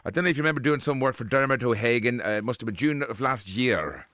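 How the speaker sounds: put-on a prank caller in a lightly done Irish accent; a hint of an Irish accent, asking a favour. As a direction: play Willie Fitzgerald, a chatty Irish fella, with a light Irish lilt. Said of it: "including me being an Irishman".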